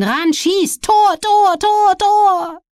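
high screaming goal call, voice breaking; cracking commentator voice. Real, not acted. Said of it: "die sich überschlagende Stimme des Kommentators".

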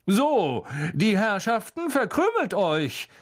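high, grand dismissal of a crowd; pompous jovial holler; calling out. A narrator's reading. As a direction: shoo them off in a projected, drawn-out call. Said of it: "rief Lockhart den Umstehenden zu".

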